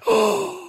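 high, sharp gasp of feigned shock; exaggerated horrified intake of breath; mock gasp. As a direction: gasp as if scandalized. Feigned shock at having to read.

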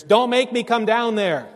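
put-on loud, high yell at the kids downstairs; parental yell from upstairs. Mom or dad to the kids in the basement, yelling. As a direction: holler it like a fed-up parent. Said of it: "have to yell from upstairs".

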